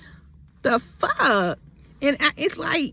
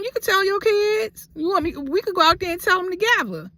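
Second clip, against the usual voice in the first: high defiant dare; 9 semitones higher than usual. Retold or imitated. Daring him in retold fight.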